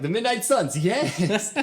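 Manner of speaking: loud, high, laughing cheer; hammed-up delight at full volume; excited fan cheer. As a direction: whoop it like a thrilled fan. Acts out own fan cheer.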